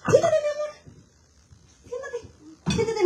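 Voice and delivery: high cooing sweet voice. Real, not acted. Talking sweetly to pet or child.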